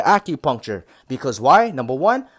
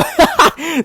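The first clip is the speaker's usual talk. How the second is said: bursting laugh, higher and louder; 6 dB louder than usual. Real, not acted. Vlogger cracking up at his own joke, high laugh. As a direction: laugh at your own rant.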